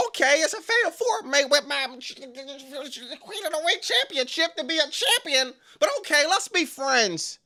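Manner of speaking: high, hammed-up meek agreeing voice; mock Julia. Julia answering Roxanne, high mock meek agreement. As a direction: plays her as naive and resigned. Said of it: "Julia says".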